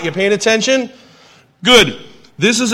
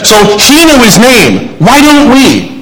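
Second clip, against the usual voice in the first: raised, high, forceful preaching voice; loud outcry; 4 semitones higher, 12 dB louder, slower than usual. Real, not acted. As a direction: belt out the question to the congregation.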